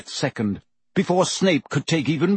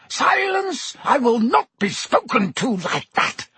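very high shriek; 11 semitones higher than usual. A narrator's reading. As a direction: scream for silence. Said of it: "Snape shrieked".

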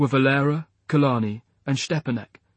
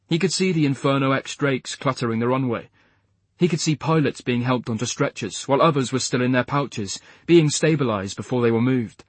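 ordinary delivery

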